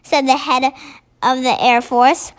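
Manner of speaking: usual speaking voice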